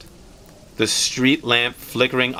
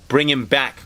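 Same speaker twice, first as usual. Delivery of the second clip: plain reading voice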